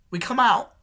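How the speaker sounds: ordinary talk, nothing marked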